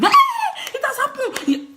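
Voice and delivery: high-pitched gullible squeal; mock childlike excitement. People easily distracted like kids, mock excited squeal. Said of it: "We're like children".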